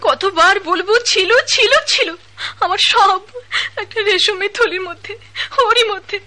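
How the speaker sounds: high, loud, tearful pleading; crying plea. Acted. Woman begs the son for her lost money, crying. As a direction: beg through tears.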